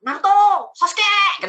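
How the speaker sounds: high, loud anime-style shout of names; mock battle cry. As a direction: yell the names like an anime fighter. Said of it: "pas perang tuh teriak". (teriakan ala anime yang dibuat-buat)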